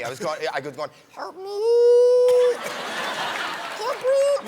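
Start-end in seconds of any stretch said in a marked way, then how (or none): 1.1-4.5 very high squeezed squeal; choking voice. Shown as a demonstration. Boy choking on cake at a birthday party, high strangled squeak. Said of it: "that's the sound that was coming out of me".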